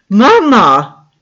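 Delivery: high, loud shocked shout; alarmed shout. A narrator's reading. Luzi sees her mother attacked: loud alarmed shout. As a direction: shout out in horror.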